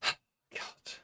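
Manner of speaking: breathy whispered sigh of god; exhausted whispered God. Retold or imitated. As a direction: whisper it, worn out by the drama. Weary sigh before imitated self-talk.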